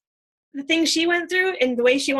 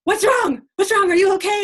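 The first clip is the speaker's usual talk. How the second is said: higher and louder, alarmed; worried friend; 8 semitones higher, 6 dB louder than usual. Retold or imitated. Friend on the phone hearing her cry, imitated alarmed concern. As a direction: sound like a friend panicking at your tears. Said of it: "And she's like".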